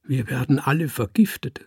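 half-sobbed cry of a frightened giant; understated sobbing giant. A narrator's reading. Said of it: "schluchzte der Riese".

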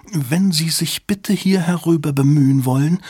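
affected lisping clerk. Acted. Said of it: "lispelte er".

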